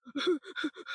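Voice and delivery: quick sobbing gasps for breath; imitated hyperventilating. Shown as a demonstration. Showing how sobbing chokes the breath, hyperventilating. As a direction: act out hyperventilating while crying. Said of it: "hyperventilating and shit".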